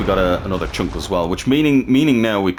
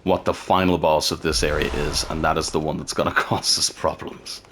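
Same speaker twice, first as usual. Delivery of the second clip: usual speaking voice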